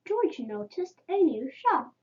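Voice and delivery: ordinary delivery, nothing marked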